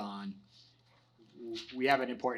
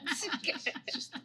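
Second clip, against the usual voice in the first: laughing. Real, not acted. Laughing at own slip.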